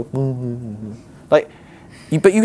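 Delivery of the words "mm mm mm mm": soft closed-mouth hums, fading away; dismissive humming. Retold or imitated. Churchgoer ignoring a snub with soft hums. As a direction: hum it off, brushing it aside. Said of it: "I can sit there and go".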